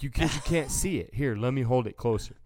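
husband shoving phone at wife's face, eager; mock eager insistence. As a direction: push the phone at her, insisting. Pushy husband to wife.

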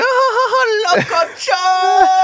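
imitating the coworker's drawn-out wail; hammed-up yell. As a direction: belt it out like the coworker, stretching it slow. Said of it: "And he's like".

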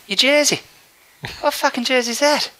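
high mock Irish drunk demanding, in an Irish accent. Irish fan confronting him on stairs, high Irish accent. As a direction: bark it like an angry Irish fan. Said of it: "the accent was Irish".

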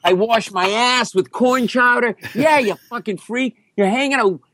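high, sarcastic mock rant; hammed-up, high-pitched fury; screaming. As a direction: rant on with absurd outraged confessions. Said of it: "and I'm screaming".

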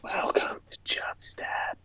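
hushed whispered welcome; whispering. Acted. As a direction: breathe the welcome without voice. Whispered show intro.

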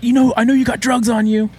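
high, loud cop impression; mock accusing cop. Cop confronting haunted house visitor, high and loud. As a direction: press the accusation, hammed up.

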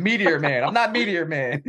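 high, laughing through the words. Pretend. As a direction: giggle while saying it. Joking superhero riff, laughing.

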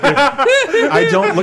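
laughing. Real, not acted.

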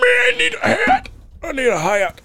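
ordinary talk

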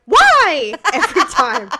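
loud, high outburst; re-enacted fed-up shout; exasperated yell. Acting wife yelling about sneezes.

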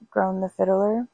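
ordinary delivery, nothing marked

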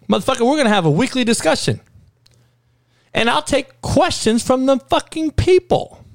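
excited rant about taking questions; fired-up pitch. Real, not acted. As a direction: sell the idea like it's genius. Pitching his weekly presidential discussion.

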